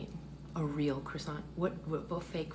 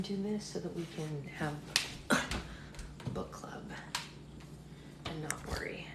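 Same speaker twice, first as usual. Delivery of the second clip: quiet at night; 5 dB quieter than usual. Real, not acted. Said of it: "when I talk like this".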